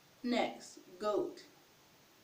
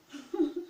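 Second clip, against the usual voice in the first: brief higher-pitched giggle; short laugh; 5 semitones higher, 4 dB louder than usual. Real, not acted. Teacher amused by her own example, short giggle. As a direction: let out a small amused laugh. Amused after saying the word.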